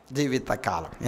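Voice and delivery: quiet reverent echo of జీవితకాలం; soft echo. Shown as a demonstration. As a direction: repeat జీవితకాలం gently, almost to yourself. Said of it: "ఏ కాలం అంట?".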